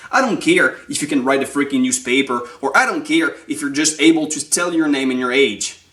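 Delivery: ordinary talk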